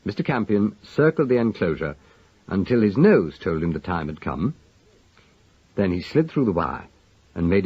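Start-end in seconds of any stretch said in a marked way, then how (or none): none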